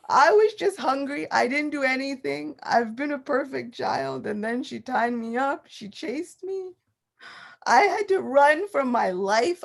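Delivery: put-on high, whiny little-boy sobbing complaint; child's teary complaint. Young Krishna complains tearfully to his father about mother. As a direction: play the wronged toddler wailing at Dad. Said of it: "now turns on the waterworks".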